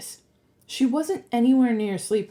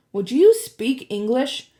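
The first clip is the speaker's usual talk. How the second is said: loud, slow, raised demand; fed-up and emphatic; exasperated interruption; 4 semitones higher, 6 dB louder than usual. A narrator's reading. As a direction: cut off the jargon impatiently. Percy cuts off the jargon.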